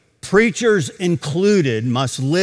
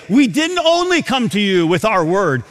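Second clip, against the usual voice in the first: ordinary talk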